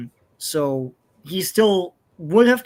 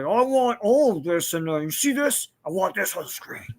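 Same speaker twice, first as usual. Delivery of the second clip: Richard Donner impression; 2 semitones higher, 3 dB quieter than usual. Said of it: "that's how he talks".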